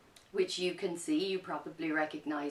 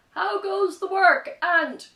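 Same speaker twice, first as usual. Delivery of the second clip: high, loud call from bed; farmer calling out; 13 semitones higher, 12 dB louder, slower than usual. Acted. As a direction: shout it out half awake. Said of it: "he called out".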